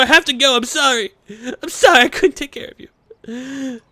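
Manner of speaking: mock tearful farewell. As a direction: overact a tragic parting. Mock tearful farewell shouting to horse.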